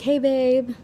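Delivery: butter him up with a sweet hey babe; wheedling tone. Retold or imitated. Artist sweetly greets her manager before admitting lateness. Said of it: "I know what that tone means".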